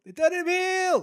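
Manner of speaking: excited shriek of disbelief; squealing disbelief. Real, not acted. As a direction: repeat it as a high squeal. Repeats the shocking news.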